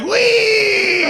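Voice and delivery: high, long-held squeal of glee; carefree wee. Retold or imitated. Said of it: "They was like".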